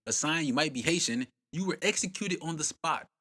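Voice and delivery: ordinary talk, nothing marked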